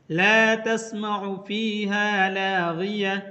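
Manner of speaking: slow, melodic tajweed chant; धीमी तिलावत की आवाज़; Quran recitation. Shown as a demonstration. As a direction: recite it slowly like tilawat. Recites Quran verse as example.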